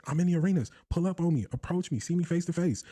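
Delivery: mock swagger of a TV pundit daring a rival; mimicking Stephen A. Host imitates Stephen A's boast.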